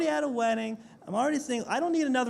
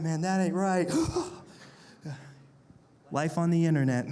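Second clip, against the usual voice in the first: ordinary talk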